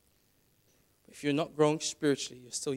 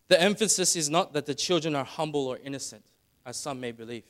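normal talking voice

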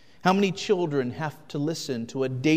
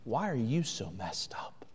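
breathy whispered accusation; inner voice whisper; 3 semitones lower, 6 dB quieter than usual. Acted. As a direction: hiss it like a nagging conscience. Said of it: "your conscience begins to scream at you".

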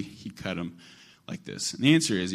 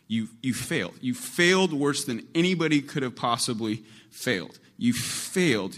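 rising rebuke; 5 semitones higher, 3 dB louder than usual. Acted. Jesus confronting Peter, preaching.

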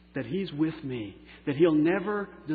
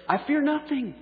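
bold proclamation; 12 semitones higher, 4 dB louder than usual. Acted. Proclaiming confident faith.

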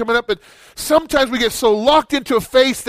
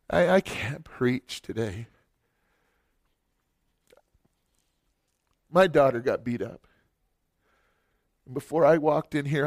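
broken, near tears; 7 semitones lower, 10 dB quieter than usual. Retold or imitated. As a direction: speak as if barely holding it together. Breaking down before congregation.

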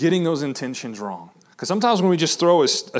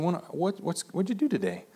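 much softer, gentle, caring question; good intention; 3 semitones higher, 10 dB quieter than usual. Shown as a demonstration. Husband gently asking tired wife about her day. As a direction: ask softly, truly wanting to know. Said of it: "can have a good intention".

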